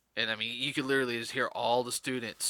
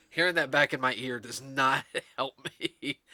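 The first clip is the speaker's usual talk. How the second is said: laughing; 3 semitones higher, 5 dB louder than usual. Real, not acted. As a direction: laugh while you say it. Cracks up at own accent.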